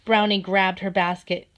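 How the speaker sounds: usual speaking voice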